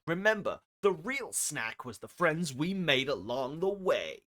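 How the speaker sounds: loud, hammed-up hype announcer; hype streamer read. Streamer reading a story made of quotes, loud hype delivery. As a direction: belt it out like an over-the-top channel promo.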